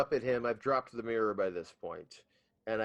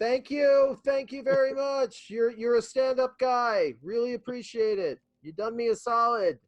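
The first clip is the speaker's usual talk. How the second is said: high, stretched-out grateful holler; high-pitched shouted-up gratitude; calling thanks to giant; 10 semitones higher, 3 dB louder than usual. Acted. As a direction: call your thanks way up to a colossus. Player waves and thanks giant.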